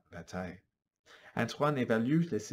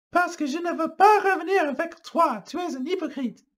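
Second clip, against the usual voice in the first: rejecting; 8 dB louder than usual. Acted. Rachel rejecting Antoine.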